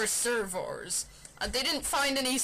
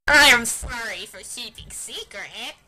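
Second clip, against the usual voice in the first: loud apology; 15 dB louder than usual. Acted. Umbreon apologizing.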